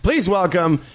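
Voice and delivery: high, hyped-up show announcer; stage MC. Acted. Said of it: "walk on stage, we go".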